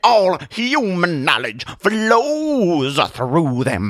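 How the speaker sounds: fervent preacher with drawn-out, swooping words; revival-tent proclamation; preaching Atheist. Acted. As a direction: preach it to a crowd, slow and exalted.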